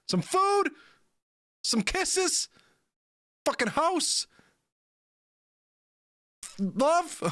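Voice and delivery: high, incredulous pleading voice, slowed down; can't believe people fight, voice shooting up high; exasperated disbelief. Real, not acted. Streamer to chat about divided peoples, high exasperated disbelief.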